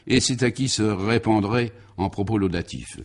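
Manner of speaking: usual speaking voice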